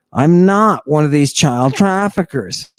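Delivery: normal talking voice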